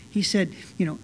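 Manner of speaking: ordinary talk, nothing marked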